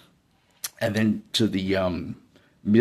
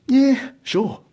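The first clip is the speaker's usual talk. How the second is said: high, casual and confident; breezy agreement; 4 dB louder than usual. Retold or imitated. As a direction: say it like nothing's wrong. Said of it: "Yeah, I go".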